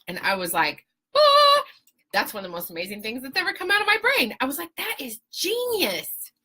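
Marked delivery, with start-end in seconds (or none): 1.2-1.6 high, loud "ah" of awe; amazed squeal. Retold or imitated. Amazed at her own brilliant idea. 4.8-6.3 high, slow, drawn-out marvelling; awed self-praise. Retold or imitated. Retelling awe at own idea.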